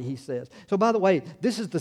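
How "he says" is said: normal talking voice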